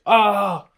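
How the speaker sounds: loud, high frustrated scream; retold scream. Reenacting screaming in the laundry room, loud scream. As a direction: let out a short scream of rage. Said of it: "on top of my lungs".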